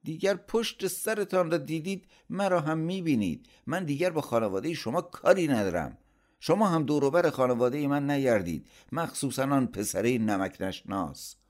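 high-pitched indignant outburst; angry. A narrator's reading. Uncle disowns his sister's family, angry. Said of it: "با عصبانیت گفت".

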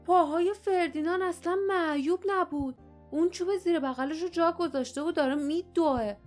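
surprised. Acted. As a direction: blurt it out, astonished at the trick. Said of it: "لیف با تعجب به آنها نگاه کرد و گفت". (با تعجب و هیجان)

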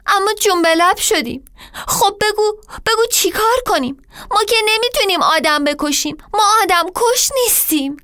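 high, fast, tearful plea; tearful pleading. Acted. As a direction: plead through tears. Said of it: "زدم زیر گریه". (التماس گریان، تند و زیر)

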